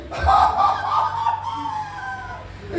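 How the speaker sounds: very high, drawn-out mocking laugh; long high mocking laugh; long scornful laugh. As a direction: cackle at the calamity you foretold. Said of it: "He said".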